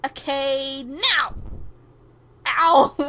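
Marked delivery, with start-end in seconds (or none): 2.5-3.0 loud, high "ow"; pained yelp. Acted. Character getting hurt in the game, loud mock pained yelp. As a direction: yelp loudly in mock pain.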